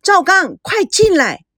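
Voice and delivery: loud high call across the room; urgent loud call for help; calling loudly. Acted. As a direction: shout his name twice, then urge him in. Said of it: "大声地叫着".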